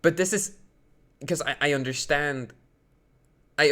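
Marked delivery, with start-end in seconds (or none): none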